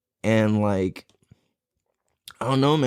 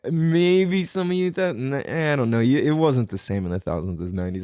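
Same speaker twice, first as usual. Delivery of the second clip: ordinary delivery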